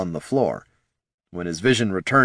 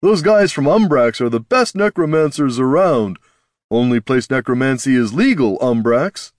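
higher, louder, cocky trader; boastful merchant voice; 4 semitones higher, 5 dB louder than usual. Acted. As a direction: sell it like a smug dealer. Clubs boasting, character voice.

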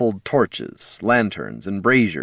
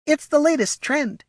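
very high, smug kobold voice; tiny-voiced, superior confidence; confiding. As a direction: share it like a fashionable secret. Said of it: "he confided to her".

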